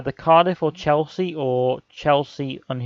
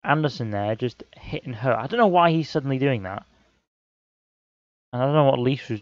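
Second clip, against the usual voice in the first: ordinary delivery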